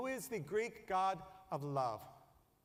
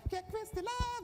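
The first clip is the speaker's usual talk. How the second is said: much higher, theatrical title call; announcer shout; 13 semitones higher than usual. Retold or imitated. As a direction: call it like a seventies TV promo. Quoting TV show title.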